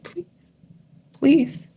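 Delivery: soft, hushed plea; quiet begging. Retold or imitated. Woman gone quiet in church begging God for help, softly. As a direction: beg gently, almost under your breath. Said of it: "And I'm quiet and I'm like".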